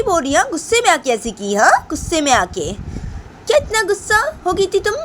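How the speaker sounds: high-pitched scolding granny voice; scolding grandmother. Acted. Grandmother scolding the daughter-in-law for hurting Bani, high outraged voice. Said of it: "तो बानी की दादी बोलती".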